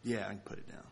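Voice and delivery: soft and subdued; quiet. Shown as a demonstration. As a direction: say it low and quiet. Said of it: "My words were saying one thing".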